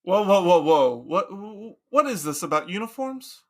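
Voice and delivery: loud startled whoa; alarmed objection. Acted. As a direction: cut him off in alarm. Socrates objecting to uniforms.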